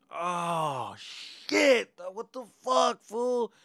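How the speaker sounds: high, loud shout of disbelief; shocked shout. Retold or imitated. Seeing the smashed front end, a high shocked shout. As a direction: yell it out in shock.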